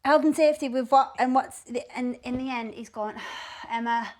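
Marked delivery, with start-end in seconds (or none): none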